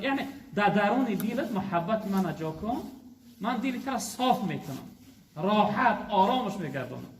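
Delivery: ordinary talk, nothing marked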